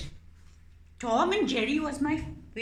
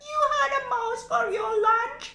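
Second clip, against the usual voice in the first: much higher, loud squeaky begging; squeaky pleading mouse; 13 semitones higher, 5 dB louder, much faster than usual. Acted. Mouse puppet begging the cat not to eat it, squeaky. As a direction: squeak for your life.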